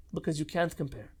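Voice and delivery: usual speaking voice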